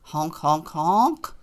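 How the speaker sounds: loud honks; loud goose call; goose honking. Acted. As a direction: honk three times like a goose. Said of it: "Jack met a goose and a dog".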